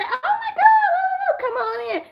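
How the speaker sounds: put-on very high, slow old-lady voice with a laugh, then dropping; mock old lady. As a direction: squeal it high and slow, then invite them in. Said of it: "So she said".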